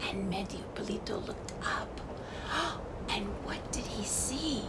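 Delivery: hushed whisper with a gasp; suspense whisper. A narrator's reading. Narrator whispering the discovery to listeners. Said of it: "whispered suspense".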